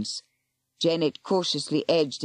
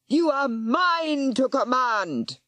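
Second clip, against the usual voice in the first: high, slow roar; 8 semitones higher, slower than usual. Acted. Warlock roaring at the serpent. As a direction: command the beast. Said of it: "he roared".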